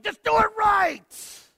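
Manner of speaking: high, loud, slow fed-up yell; exasperated shout. Retold or imitated. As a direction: blast it like an impatient parent. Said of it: "And I'm like".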